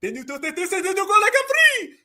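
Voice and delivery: starts hushed, climbs to a high strain; mocking imitation. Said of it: "talking down like this".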